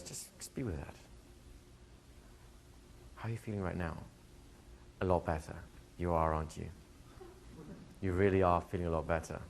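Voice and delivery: soft, low-key soothing voice; imitating a gentle, reassuring coach; calm coaching. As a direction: settle the client quietly, leaving long pauses. Voicing coach calming a client.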